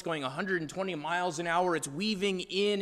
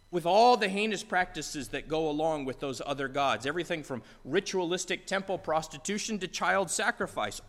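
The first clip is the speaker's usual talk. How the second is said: ordinary talk, nothing marked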